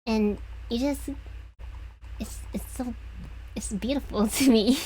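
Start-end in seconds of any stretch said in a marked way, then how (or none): 2.2-4.9 breaking into a higher-pitched laugh. Real, not acted. Speaker admitting they find it beautiful, laughing. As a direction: say it through a real, rising laugh. Laughs while confessing what moves them.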